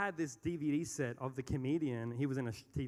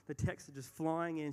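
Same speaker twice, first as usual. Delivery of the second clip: ordinary delivery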